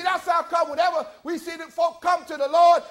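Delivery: usual speaking voice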